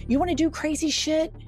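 ordinary delivery

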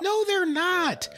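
a slow incredulous no, dragged out high; emphatic denial. Real, not acted. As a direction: shut the claim down word by word. Flatly rejecting the litter box claim.

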